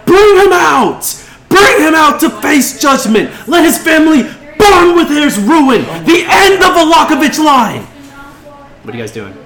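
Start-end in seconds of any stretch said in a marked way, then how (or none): 0.0-7.8 high, full-force mob-leader shouting; furious yell. Acted. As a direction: scream for judgment at the top of your voice. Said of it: "she yells".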